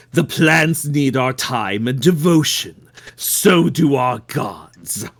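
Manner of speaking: measured, solemn cult devotee voice; calm solemn devotee. Acted. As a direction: speak like a serene zealot. Player voices Witness character.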